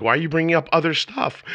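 high-pitched mock protest; playfully hammed-up objection; mock exasperation. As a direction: complain in comic disbelief. Acting out protest to his wife.

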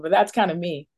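ordinary talk

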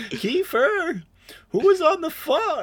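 high, mock-sweet mom voice calling upstairs; imitating a mom calling her son in a high voice. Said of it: "his mom calls him and is like".

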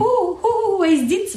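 high excited oohs; giddy oohing. Retold or imitated. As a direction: squeal with sneaky excitement. Retold sneaky excited viewing.

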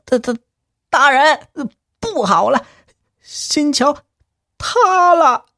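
high, stammering panic; panicked stammer. Acted. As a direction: blurt it out terrified, stumbling. Panicked clerk bursts in.

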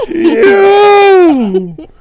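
high, stretched playful holler; drawn-out mock cry. Clowning with a friend, drawn-out high cry. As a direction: hold it long and high, goofing.

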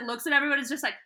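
ordinary talk, nothing marked

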